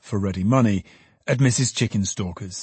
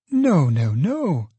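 Meg, fond protest; 5 semitones higher, much slower than usual. Acted. Meg objecting.

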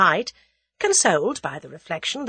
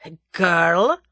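Russian conspirator voice; 5 dB louder than usual. A narrator's reading. Russian presses German.

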